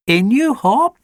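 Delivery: high, bright-voiced coaxing of a pet. A narrator's reading. Charlie coaxes Alfie onto the beanbag, high coaxing. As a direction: lure the dog into bed. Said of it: "coaxed Charlie".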